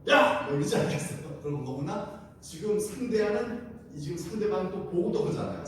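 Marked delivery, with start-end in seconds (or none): 0.1-0.5 sudden loud, high yell; cursing shout. Acted. Ancient detective bursts into Rahab's house, loud bullying shout. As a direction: yell it like a rough interrogator. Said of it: "욕을 막 하면서".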